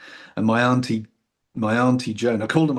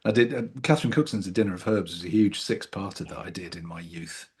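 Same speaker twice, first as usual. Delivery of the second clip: ordinary talk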